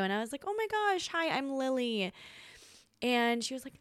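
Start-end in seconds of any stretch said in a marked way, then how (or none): 0.5-2.1 fake sweet. Overly sweet greeting to girlfriend.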